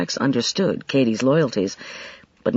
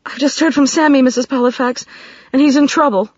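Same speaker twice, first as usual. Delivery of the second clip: high, louder, tumbling out in alarm; blurting out; 11 semitones higher, 5 dB louder than usual. A narrator's reading. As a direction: blurt it out, frightened young woman. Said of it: "Katie was blurting out".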